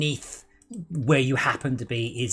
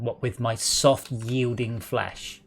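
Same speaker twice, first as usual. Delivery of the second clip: slow drawn-out; much slower than usual. Pretend. Mock self-pity about frailty.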